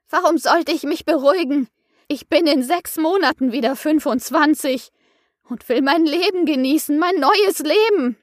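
lightly voiced indignant outburst; snapping at her. A narrator's reading. Matthias snaps at the coach about his new life, heated. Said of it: "Matthias fuhr sie an".

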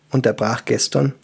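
ordinary delivery, nothing marked

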